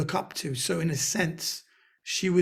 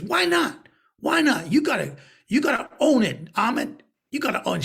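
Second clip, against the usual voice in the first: insistent urging; 7 semitones higher, 4 dB louder than usual. Retold or imitated. Retold friend insisting loudly.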